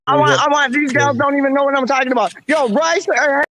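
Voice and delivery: high, loud excited burst; rushing to get the words out. Real, not acted. Streamer excitedly cutting in over the girls.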